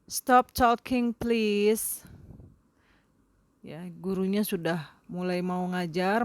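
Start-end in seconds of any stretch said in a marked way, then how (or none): 0.1-2.0 loud, slow call to stop the chatter; teacher hushing class. Shown as a demonstration. Teacher to chattering students, loud firm call. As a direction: call out firmly over the noise, louder and slower.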